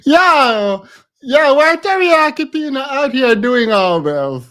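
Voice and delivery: yo, come on, what is this; incredulous loud call-out. Real, not acted. Host reacting to the clip, loud disbelief.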